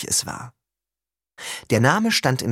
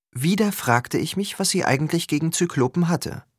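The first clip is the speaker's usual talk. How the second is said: plain reading voice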